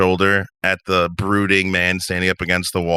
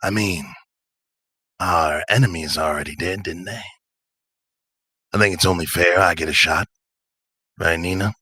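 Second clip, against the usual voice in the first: easygoing character talking his way out; smiling, unbothered self-defence; laid-back drifter; 3 semitones lower than usual. Acted. Patch placates an angry Nina, laid-back. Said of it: "Patch smile and just kinda lead back".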